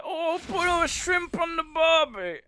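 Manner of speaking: high, loud, drawn-out mock Australian; terrible Australian accent. Streamer clowning for viewers, hammed-up terrible Australian accent. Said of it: "wanted to do an Australian accent".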